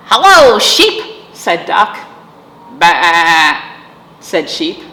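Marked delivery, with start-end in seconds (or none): 0.1-1.3 high, loud cheery duck hello; Duck's greeting. A narrator's reading. Storyteller voicing Duck greeting Cow, high and loud. 2.8-3.6 loud bleating baa; sheep baa. A narrator's reading. Storyteller bleating as Sheep to Duck.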